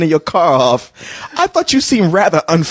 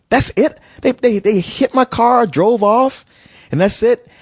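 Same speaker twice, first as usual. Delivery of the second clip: incredulous; 7 semitones higher, slower than usual. Retold or imitated. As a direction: voice jumps high, slow and disbelieving. Incredulous at cop's lenient answer.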